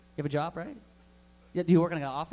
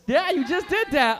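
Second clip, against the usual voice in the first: normal talking voice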